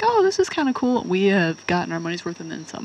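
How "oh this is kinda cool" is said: pleasantly surprised. Retold or imitated. Retold delighted reaction to gift.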